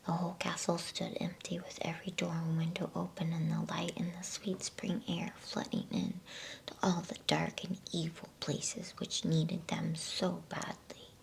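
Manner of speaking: ordinary delivery, nothing marked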